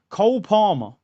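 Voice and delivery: ordinary talk, nothing marked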